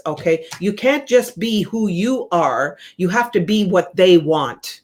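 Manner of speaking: ordinary delivery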